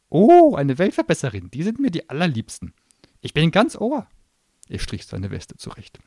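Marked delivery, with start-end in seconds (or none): 0.1-4.1 high, loud sneering gnome voice; a cornered little demon spitting scorn; shrill gnome tirade. Acted. Gnome mocking.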